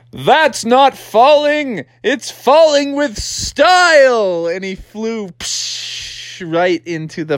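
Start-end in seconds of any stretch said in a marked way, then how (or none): 0.1-4.5 high, slow showy declaration; stretched-out, hammed-up bravado; swaggering frog dad. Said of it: "And he's like". 5.3-6.4 voiceless rushing "psh"; soft hissed whoosh; whooshing fall. Acted. Imitates the fall.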